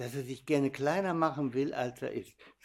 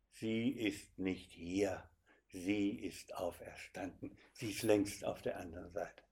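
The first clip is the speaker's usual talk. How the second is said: soft, slow, hushed proclamation; quiet awe; 5 semitones lower, 10 dB quieter than usual. Acted. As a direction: speak it softly like a secret of faith. Quoting the Easter message at grave. (leise und andächtig)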